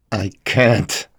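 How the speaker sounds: a touch of fear, words cut short; clipped off by fear; fearful. A narrator's reading. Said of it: "every word cut short by fear".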